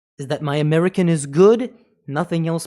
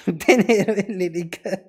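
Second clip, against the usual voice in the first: laughing; 2 semitones higher than usual. Real, not acted. Repeats it laughing.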